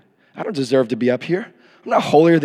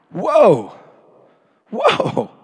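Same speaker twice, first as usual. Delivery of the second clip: amazed whoa; 6 semitones higher than usual. Acted. Reacts to pronounced alive/dead.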